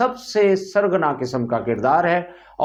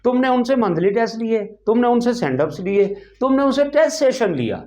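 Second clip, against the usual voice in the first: indignant rant; 6 semitones higher than usual. Acted. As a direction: demand answers, pitched well above normal talk. Indignant questioning before the greeting. (اونچی آواز میں برہم بازپرس)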